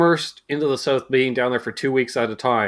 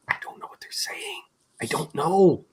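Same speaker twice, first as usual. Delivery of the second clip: baffled whisper; 8 dB quieter than usual. Retold or imitated. Coworkers baffled by the caller.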